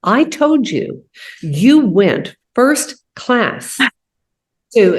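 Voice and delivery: a teacher scolding, drawn out word by word; mock exasperation. Muhammad scolding his student, in slow mock exasperation. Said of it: "Muhammad was furious".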